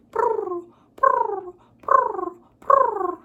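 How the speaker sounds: high, loud cooing purrs; pigeon purr. Retold or imitated. Reading a picture book to children, a loud high pigeon coo. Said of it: "They say".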